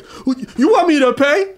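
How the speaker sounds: hammed-up astonished customer; shocked retold question. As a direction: blurt it out in high-pitched indignation. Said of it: "He's like".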